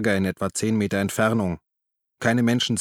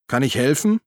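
higher, raised questioning call; 4 semitones higher, 4 dB louder than usual. A narrator's reading. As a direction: call down into a ditch. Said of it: "und rief". (fragend hinuntergerufen)

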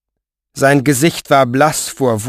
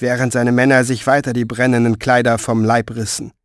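plain reading voice

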